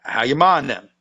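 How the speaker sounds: "mind them" squeezed into MA'N'EM; how-ya-MA'N'EM, falling at the end; Southern contraction. Shown as a demonstration. Storyteller repeats it for a puzzled cohost, run together as ma'n'em. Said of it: "you're mentioning the mother".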